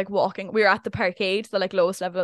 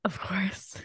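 whispered aside; 10 dB quieter than usual. Real, not acted. Wry aside after teasing.

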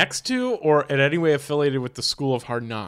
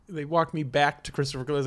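normal talking voice

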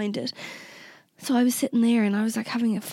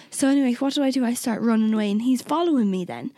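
ordinary delivery

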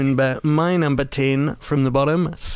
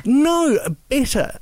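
mock emphatic; 12 semitones higher than usual. Real, not acted. Joking overstatement about the film.

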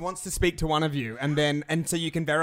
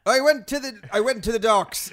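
mock Irish husband, pitched higher and louder; lightly put-on Irish brogue, louder; 6 semitones higher, 5 dB louder than usual. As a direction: ham up an Irish accent, a touch higher. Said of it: "I'll pretend to be an Irish husband for you".

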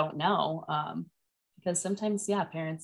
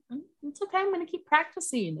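put-on four-year-old son; 12 semitones higher, faster than usual. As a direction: imitate a small child calming himself. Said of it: "took a quick deep breath".

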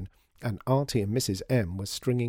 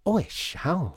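high, swooping agreement; gushing promise; 8 semitones higher, slower than usual. Acted. Customer agreeing to tell everyone, gushing. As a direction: promise it with delight.

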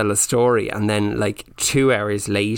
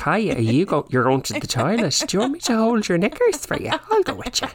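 much higher, fast, sing-song eager offer; overeager escape; 10 semitones higher, faster than usual. Retold or imitated. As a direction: grab any excuse to leave the table. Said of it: "to be like".

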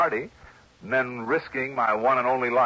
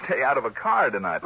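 ordinary talk

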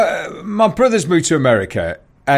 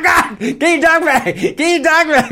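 much higher frantic mock shouting; panicked woman imitation; 12 semitones higher than usual. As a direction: play a frantic woman ordering people about. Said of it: "woman's going".

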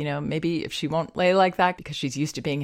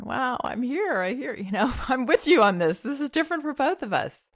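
higher, tender reassurance; soothing baby talk; 9 semitones higher than usual. Shown as a demonstration. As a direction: comfort the baby, voice lifted.

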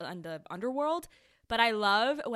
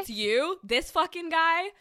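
surprised; 8 semitones higher, 7 dB louder, slower than usual. Retold or imitated. Echoing the crowd's disbelief.

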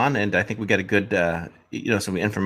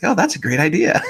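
high, laughing mock praise; sarcastic "great idea" with a laugh; 9 semitones higher than usual. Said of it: "I'm like".

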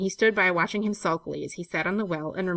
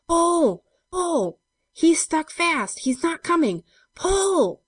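high, loud, drawn-out yells; urgent high-pitched cries of effort; desperate shouting; 11 semitones higher, 6 dB louder, much slower than usual. A narrator's reading. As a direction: shout desperately as you haul. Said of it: "shouted George".

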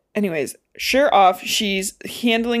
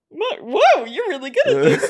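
high-pitched, laughing mock surprise; acted amazement; 11 semitones higher than usual. Girl startled by Peeta's hiding skill, amazed. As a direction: exclaim it, amused and impressed. Acting girl's surprise at camouflage.

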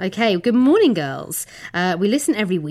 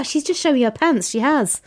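ordinary talk, nothing marked

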